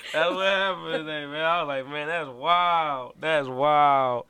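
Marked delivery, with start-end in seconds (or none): 1.9-4.3 stunned, drawn out. Retold or imitated. Retelling own stunned reaction.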